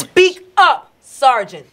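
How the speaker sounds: loud, high, punched order; forceful command. Acted. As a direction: order the witness sharply. Lawyer orders witness to speak louder.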